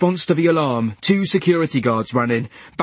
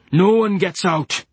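slow, higher, grim; bitter patient voice; 6 semitones higher, slower than usual. A narrator's reading. Trapped patient to the nurse, grim and slow. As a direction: spit it out with contempt. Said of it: "said Leach".

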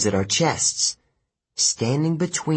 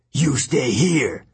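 slow giant voice; 3 semitones higher, slower than usual. Acted. Giant orders Annabeth.

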